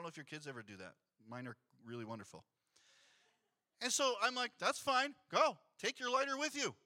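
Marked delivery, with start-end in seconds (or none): 4.6-6.7 fed-up dismissal. Retold or imitated. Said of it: "Bad parenting, okay?".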